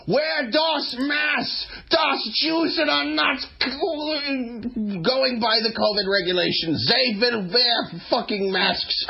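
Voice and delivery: put-on high, slow, shouted German accent; mock German accent. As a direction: bark it like a German officer. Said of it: "it feels".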